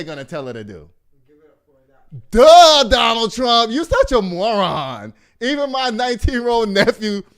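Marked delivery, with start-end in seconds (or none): 2.3-5.1 a big high Duh, then a slower loud put-down; loud mocking scorn. Real, not acted. Ranting at Trump about his debate claim, loud and scornful. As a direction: shout the insult at him, fed up.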